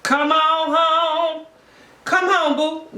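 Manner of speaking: light high coaxing call, slow; mock sweet coaxing. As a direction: coax him home sweetly. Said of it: "she probably be like".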